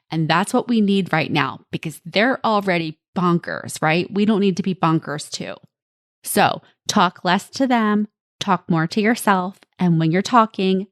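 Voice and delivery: ordinary talk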